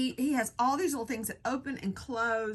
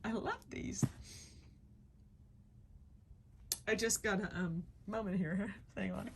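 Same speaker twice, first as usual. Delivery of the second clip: softer, choked up with love; quiet emotional moment; near tears; 9 dB quieter than usual. Real, not acted. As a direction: pause to collect yourself, close to crying. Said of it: "this is making me cry".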